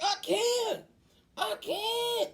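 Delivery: high, loud strained wail; mock labor cry. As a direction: act out a woman in labor crying out. Mother in labor crying out.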